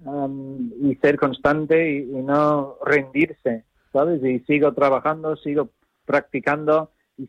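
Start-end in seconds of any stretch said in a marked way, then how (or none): none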